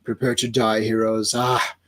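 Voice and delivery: deep, slow gloating villain; low slow villain. Acted. Villain gloating over captured Legionnaires, low and slow. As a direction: savor the trap like a villain. Villain voiced reading comic.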